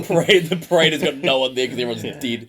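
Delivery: high, loud comic outburst; mock parade shout. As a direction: yell it over a celebration, half laughing. Riffing on an empty victory parade.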